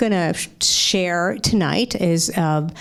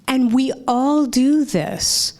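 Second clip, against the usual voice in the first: ordinary talk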